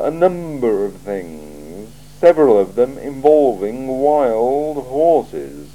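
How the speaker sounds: a cool ruler weighing every word; icy, deliberate interrogator; Lord Vetinari. Acted. Vetinari lists complaints.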